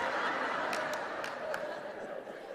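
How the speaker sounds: soft unvoiced laughter; breathless laugh. Real, not acted. Pastor laughing at her own story, soft breathy laugh.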